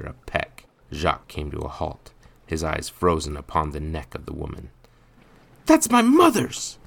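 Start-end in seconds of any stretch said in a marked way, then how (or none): 5.7-6.8 put-on boy's very high, loud cry; outraged yell. A narrator's reading. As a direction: yell it high, claiming what is your mother's. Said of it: "With a yell".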